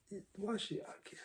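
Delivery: breathy whispered why should I care; whispered shrug. Retold or imitated. To viewers, dismissing others' stories, whispered. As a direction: whisper it like you truly don't care.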